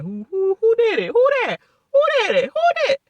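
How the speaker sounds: very high, loud mock innocence; mocking houseguests. Houseguests playing dumb over the blindside, mocked in a very high squeal. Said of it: "everybody was playing stupid as shit".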